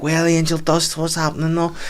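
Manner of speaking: high-pitched imitation of a woman; lightly voiced woman, high; mock drunk woman's greeting. Woman greets him, retold.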